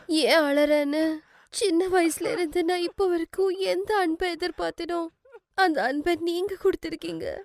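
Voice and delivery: high, tearful voice sustained throughout; crying. Acted. Daughter-in-law telling of her motherless childhood, crying. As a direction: pour out old hurt through tears. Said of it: "Simran அழறா".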